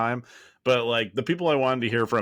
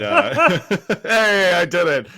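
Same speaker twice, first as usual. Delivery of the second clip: gleeful; 10 semitones higher, 9 dB louder, slower than usual. Real, not acted. Pun celebrated with laughter.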